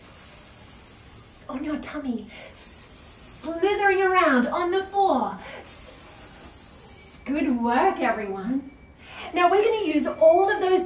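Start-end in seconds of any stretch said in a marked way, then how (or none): 0.0-1.5 long whispered hiss; snake hiss. Pretend. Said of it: "find your cobra snake". 5.6-7.2 long whispered hiss; snake hiss. Pretend. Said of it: "find your cobra snake".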